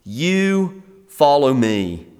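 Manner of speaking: slow, every word of "Peter, you follow me" weighted, falling at the end; emphatic command. Retold or imitated. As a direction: lay heavy stress on "you", slow and deliberate. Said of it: "It's emphatic in the Greek".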